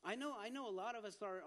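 normal talking voice

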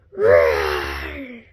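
low, rough growled roar; dinosaur roar. Acted. Dinosaur interrupts the players, growling roar. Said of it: "give me your best dinosaur roar".

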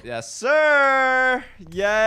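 loud, high victory yell; drawn-out, high celebratory holler; triumphant shout. Real, not acted. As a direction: crow loudly over the win. Celebrates getting through.

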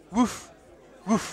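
imitating a dog, a soft woof twice; dog bark. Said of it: "brigade d'intervention spéciale canine".

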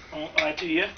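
ordinary talk, nothing marked

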